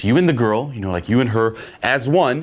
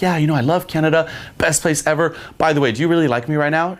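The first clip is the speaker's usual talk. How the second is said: quick, higher-pitched chatty gushing; feigned enthusiasm; 8 semitones higher, faster than usual. Acted. Man pretends to love Canada to a girl, feigned enthusiasm. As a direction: lay on the casual enthusiasm.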